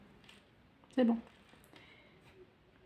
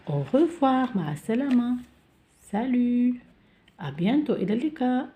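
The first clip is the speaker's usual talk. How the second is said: higher, louder goodbyes; lightly voiced child farewells; 6 semitones higher, 3 dB louder than usual. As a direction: wave goodbye as a pupil. Acting dialogue farewells. (وداع بصوت طفل أعلى)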